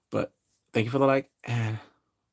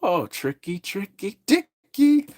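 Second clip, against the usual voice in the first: climbing higher into a loud mocking squeal; taunting Pennywise; 8 semitones higher, 3 dB louder, slower than usual. Pennywise catching Halloran in his head, rising mocking squeal. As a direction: tease like a gleeful clown. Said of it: "He's like".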